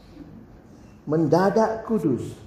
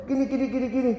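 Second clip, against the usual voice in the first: put-on Jakarta teen; 5 semitones higher, faster than usual. Teenager complains to the pastor about his mother, high and fast. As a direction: complain like a fed-up Jakarta kid. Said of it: "Anak Jakarta ya".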